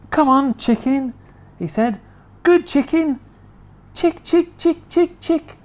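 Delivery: high, sweet chick-chick call; coaxing George. Acted. George coaxing the chicken with a spoonful, high and sweet. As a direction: coax the chicken over gently.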